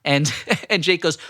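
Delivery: ordinary talk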